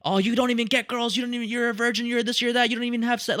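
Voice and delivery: high-pitched mock taunting; mock taunting chick. Streamer imitating chicks talking about incels, high mocking taunt. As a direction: imitate girls putting down incels, pitched well up. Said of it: "a lot of chicks talk about incels that way".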